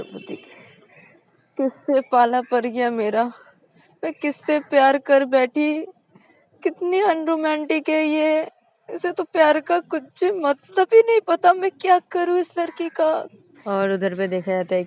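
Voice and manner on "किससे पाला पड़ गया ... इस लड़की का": high, loud despairing rant; lamenting girlfriend. A narrator's reading. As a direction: grumble to yourself in despair. Shreya lamenting. (निराश होकर ऊँची आवाज़ में बड़बड़ाना)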